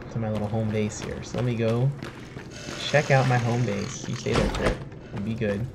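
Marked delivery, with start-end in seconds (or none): none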